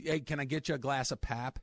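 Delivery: mock Midwestern accent with flat vowels. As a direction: flatten the vowels, Midwestern style. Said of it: "weird Midwestern one with the flat vowels".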